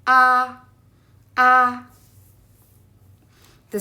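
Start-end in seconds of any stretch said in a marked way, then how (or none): 0.1-1.8 "A" held level and loud, no pitch movement; a flat, smooth loud "A"; first tone again. Shown as a demonstration. Teacher repeating the first tone for learners, level "A". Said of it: "It's flat and smooth".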